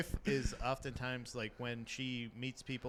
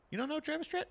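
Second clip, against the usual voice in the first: incredulous; much faster than usual. Real, not acted. Incredulous at friend.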